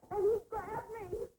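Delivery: high tearful speech; reliving the attack through tears; crying. Acted. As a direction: break down crying as you tell it. Recounting the attack, breaking down.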